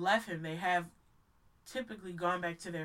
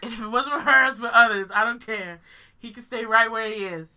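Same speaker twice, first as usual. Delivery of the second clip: loud, firm and fed up; emphatic loud; 2 semitones higher, 13 dB louder than usual. Real, not acted. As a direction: declare it loudly, done caring. Ranting about abuser.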